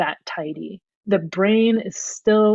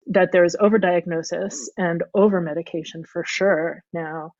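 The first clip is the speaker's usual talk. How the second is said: ordinary talk, nothing marked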